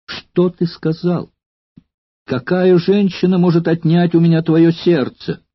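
Valeria cries out in alarm at his words; agitated exclaim. A narrator's reading. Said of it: "В волнении она воскликнула". (взволнованно восклицая)

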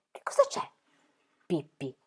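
hushed, anxious question; worried. A narrator's reading. Annika asks Pippi what is wrong, worried. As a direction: ask it softly, worried. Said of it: "chiese Annika preoccupata".

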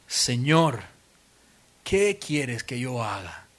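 low, slow, hushed plea; a frightened Saul asking in surrender; trembling, fearful. Acted. Preacher voices a trembling Saul answering the Lord, low and slow. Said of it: "temblando y temeroso".

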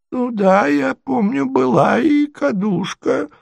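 put-on high, dreamy old-prince voice; prince's absent muttering. A narrator's reading. Prince vaguely recalls the insult, muttering absently. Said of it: "пробормотал бессознательно князь".

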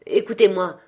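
loud commanding spectral call; ghostly cry. Acted. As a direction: demand his attention with a cry. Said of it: "s'écria le fantôme". (le fantôme s'écrie, fort et impérieux)

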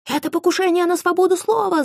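high indignant protest. A narrator's reading. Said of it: "запротестовал журналист".

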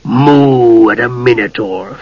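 slow, grumbling dwarf disbelief; humphing. A narrator's reading. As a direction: drag it out with a scornful humph. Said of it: "Flint humphed".

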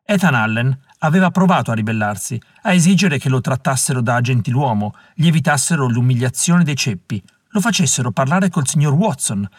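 plain reading voice

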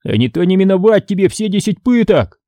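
high-pitched threat; threatening. A narrator's reading. Moose looming over Joe, threatening. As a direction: warn him of dire consequences.

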